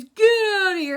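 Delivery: high, loud scream. Retold or imitated. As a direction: yell it with a cry in the voice. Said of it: "he goes".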